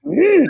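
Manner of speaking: very high, loud creaturely hum; hammed-up bad impression of an alien "mm"; Yoda. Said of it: "that's where her impression is".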